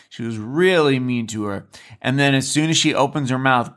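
ordinary talk, nothing marked

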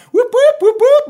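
very high, loud whooping siren; mock sirens, high and loud; siren imitation. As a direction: wail like several cop sirens. Imitating arriving police sirens.

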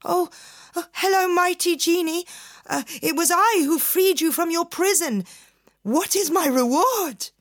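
high, fast pleading voice; timid fisherman. Acted. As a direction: grovel nervously.

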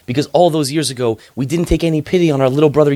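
guilt-stricken. Acted. Brothers confess guilt to each other.

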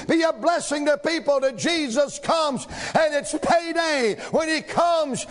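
chanted shouted refrains, each line hammered; preaching at full force. Acted. Preacher rallying the church, building shouted refrain. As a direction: whip the church up toward the close.

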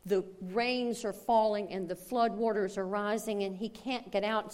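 ordinary talk

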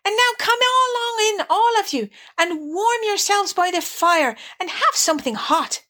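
high, hearty welcome. Acted. Rat invites the field mice to the fire, hearty. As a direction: usher them all in with hearty good cheer. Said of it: "cried the Rat heartily".

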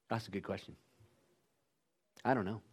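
low, soft, hesitant parent's answer; quiet admission. Retold or imitated. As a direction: admit it quietly to the kids. Parent answering kids' questions.

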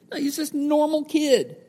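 ordinary talk

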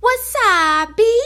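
high, loud, drawn-out mock gangster holler; imitated kid's swaggering "whassup" yell; gangster-style punchline. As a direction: belt the punchline like a little boy playing gangster. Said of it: "throws his hands up gangster style and goes".

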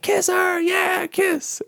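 very high mock crowd chant; crowd cheering. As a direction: egg them on like a cheering crowd.

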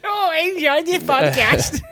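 high, slow and laughing through it; drawn-out mocking impression of her; mock listener voice, laughing.

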